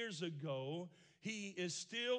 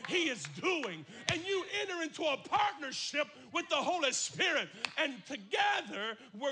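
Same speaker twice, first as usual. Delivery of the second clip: loud, rising preaching; full-force preaching; 5 semitones higher, 6 dB louder than usual. Acted. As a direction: build them up loud.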